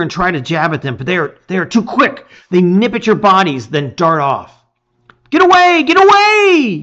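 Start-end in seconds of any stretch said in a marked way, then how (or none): 5.3-6.8 high, loud panicked yell; panicked shout. A narrator's reading. Lizard boy fending off night creatures, panicked shout. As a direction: scream at the attackers in panic. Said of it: "shout, panic in his voice".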